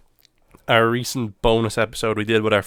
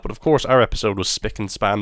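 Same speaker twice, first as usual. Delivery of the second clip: ordinary talk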